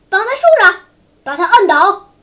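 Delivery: loud barked orders; clamorous shouting. A narrator's reading. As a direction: shout commands like a gang all at once. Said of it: "七嘴八舌地喊道". (七嘴八舌地大喊)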